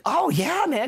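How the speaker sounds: imitating an eager, high-pitched reply; excited. Composer's colleague answers students asking for the score, excited. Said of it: "he was, like, all excited".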